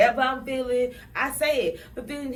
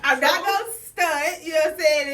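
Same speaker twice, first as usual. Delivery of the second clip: laughing, higher and louder; 6 semitones higher, 5 dB louder than usual. Real, not acted. Admitting her harshness to friends, laughing. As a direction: laugh through the admission.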